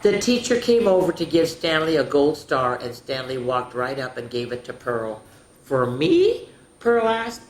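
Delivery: put-on lively read-aloud for little kids; storybook narration. A narrator's reading. Teacher reading Stanley's school story to children. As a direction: tell it like a bedtime story to a class. Reads picture book to children.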